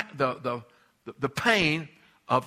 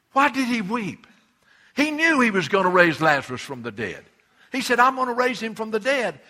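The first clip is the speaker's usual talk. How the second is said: higher, louder fervent preaching; full preaching; 5 semitones higher, 6 dB louder than usual. Acted. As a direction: drive it home to the congregation. Sermon climax, voice rises.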